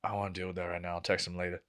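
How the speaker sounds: low, soft inner grumble; reluctant mumble. Retold or imitated. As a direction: put it off under your breath. Voicing reluctant thought at early morning.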